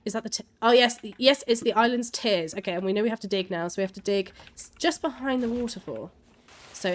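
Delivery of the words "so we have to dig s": ordinary delivery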